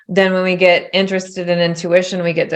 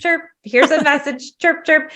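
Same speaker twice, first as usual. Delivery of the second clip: high, clipped chirping guide; chirpy guide; 9 semitones higher than usual. Retold or imitated. A shy introverted guide chirping messages, high and quick. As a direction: chirp it out like a tiny bird, pitched up. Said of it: "it was like".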